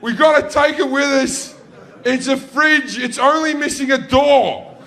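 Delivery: put-on high, loud excited child; a kid begging dad to keep junk; child calling to dad at the tip, thrilled.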